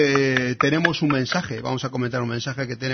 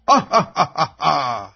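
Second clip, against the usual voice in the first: mustache twirling villain; 6 dB louder, much slower than usual. Pretend. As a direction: cackle like a cartoon villain. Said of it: "Tengo que hacer la risa malvada".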